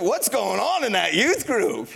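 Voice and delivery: loud, indignant parent; mock indignation; suspicious parent. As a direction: parent suspicious of the youth group. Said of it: "your parents are like".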